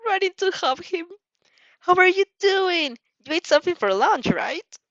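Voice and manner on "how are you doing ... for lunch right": high excited fussing. Acted. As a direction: fuss over him, high and bright. Fussing over Izuku happily.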